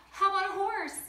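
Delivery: waking parents with a high eager pitch; excited girl. Acted. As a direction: burst out eagerly like a kid.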